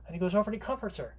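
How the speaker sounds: ordinary talk, nothing marked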